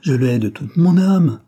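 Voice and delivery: high, loud cry of hatred; the countess bursts out to Arush, high and loud; explosive outburst. A narrator's reading. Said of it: "s'écria la comtesse avec explosion".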